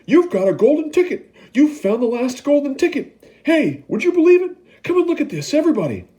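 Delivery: excited scream. Acted. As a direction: call the whole shop over. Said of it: "screamed the shopkeeper".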